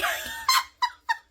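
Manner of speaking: shrieking burst of laughter; high squealing laugh. Real, not acted. Teller cracking up retelling the exchange, high laugh.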